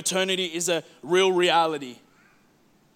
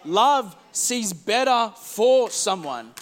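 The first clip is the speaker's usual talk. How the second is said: slow emphasis; much slower than usual. Acted.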